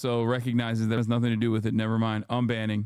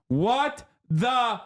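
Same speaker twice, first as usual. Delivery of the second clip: mock outrage; 10 semitones higher, much slower than usual. Reading chat message mockingly.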